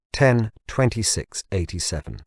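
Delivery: plain reading voice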